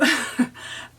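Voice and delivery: brief amused laugh. Real, not acted. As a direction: laugh between quotes. Amused while retelling.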